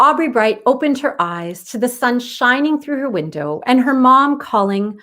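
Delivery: put-on bright read-aloud voice for children; story narration. A narrator's reading. Author reading her book to young listeners, bright and lively. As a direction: read it like a picture book to kids.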